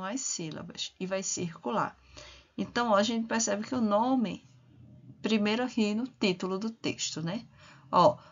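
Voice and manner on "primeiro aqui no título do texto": ordinary delivery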